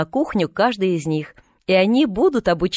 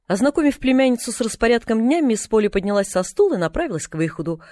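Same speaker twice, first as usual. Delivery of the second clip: ordinary delivery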